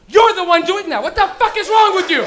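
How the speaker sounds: loud, high scolding; mock angry confrontation. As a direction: imitate an outraged stranger yelling accusations. Woman confronts him on the subway.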